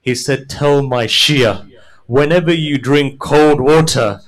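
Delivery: mournful preaching. Acted. Imam telling Minhal his grief, building lament.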